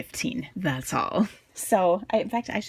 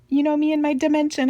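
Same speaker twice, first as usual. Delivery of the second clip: excited; 9 semitones higher, 4 dB louder, faster than usual. Real, not acted. Playful repeat, bigger second take.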